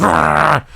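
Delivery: mimicked attacking-dog roar; imitated dog snarl. Supposedly friendly dog suddenly going for another, snarling. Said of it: "as the dog suddenly goes".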